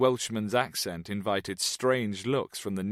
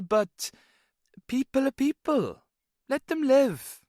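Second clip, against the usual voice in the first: high lilting Welsh expat; cheerful boozy Welshman, higher; Welsh man voice; 7 semitones higher than usual. Acted. Jones voiced, Welsh lilt.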